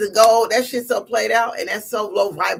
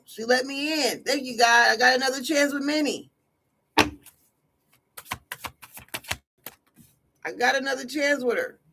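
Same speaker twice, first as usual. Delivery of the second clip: higher-pitched mock rejoicing; happy celebration; 3 semitones higher than usual. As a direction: imitate him gloating over his second chance. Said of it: "He's happy".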